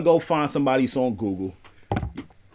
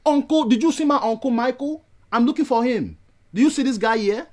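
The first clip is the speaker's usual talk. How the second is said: higher-pitched, imitated Nigerian nephew; put-on Nigerian accent; 7 semitones higher than usual. Visitor asks after his uncle, put-on Nigerian accent. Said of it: "Did you put your accent on?".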